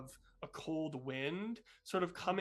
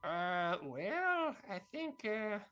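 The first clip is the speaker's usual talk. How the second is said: lightly voiced, halting character; hesitant Socks Senior; 5 semitones higher, much slower than usual. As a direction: stall for time, slightly higher. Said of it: "Socks Senior's like".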